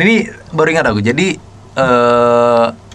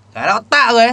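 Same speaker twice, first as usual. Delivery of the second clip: Madurese accent; 7 semitones higher, 4 dB louder than usual. Shown as a demonstration. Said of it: "Nah, gitu".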